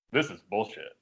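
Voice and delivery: retold low, quiet grumble; understated, fed-up aside; low grumbled complaint. Retelling his muttered complaint to Drew.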